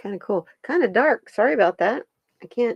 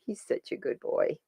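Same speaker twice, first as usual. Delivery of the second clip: soft whispered praise; whispered pet praise; 8 dB quieter than usual. Real, not acted. Praising the dog in a whisper.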